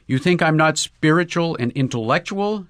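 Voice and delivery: a man stung and moved; deeply moved. A narrator's reading. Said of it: "deeply moved".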